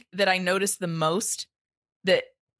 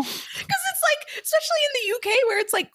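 mock whine. Mock whine about missing soft Kleenex.

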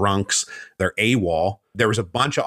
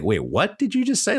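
pitched way up, a mock-astonished double take; startled double take; 13 semitones higher than usual. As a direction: play Washington caught off guard, voice jumping high.

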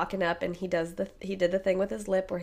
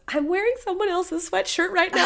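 high, frantic panicked pleading; panicked thoughts; 12 semitones higher, 6 dB louder than usual. Retold or imitated. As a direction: panic inside your head, pitched way up. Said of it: "all I could think was".

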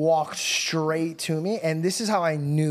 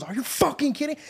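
higher-pitched mock fury without shouting; retold outrage; 7 semitones higher than usual. Uncle grabbing the stranger, retold outrage. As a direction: play an incredulous uncle losing it. Uncle grabs man, furious.

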